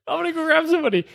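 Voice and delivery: high, fast, panicky excuse; flustered escape. Retold or imitated. Store worker escapes the customer to fetch help, flustered. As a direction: blurt it high and hurried to get away.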